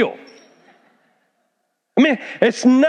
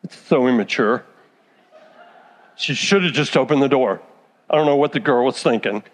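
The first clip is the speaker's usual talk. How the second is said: mock judgmental churchgoer; 9 semitones lower than usual. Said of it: "Some of you be like".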